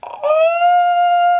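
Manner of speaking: very high, loud shocked "Oh". Retold or imitated. Bree turning to friends after glass shatters, high shocked oh. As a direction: gasp it out in shock. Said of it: "I turn to my friends and I'm like".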